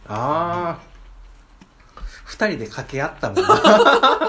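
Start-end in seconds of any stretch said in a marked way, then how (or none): 2.2-4.3 loud laughing, slow. Real, not acted. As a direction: laugh through the words. Laughing recalling trying on glasses.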